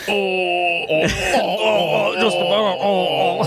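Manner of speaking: high fake sobbing oh's; mock crying wail. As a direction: wail like a hammed-up weeper. Acting out Grace's scene.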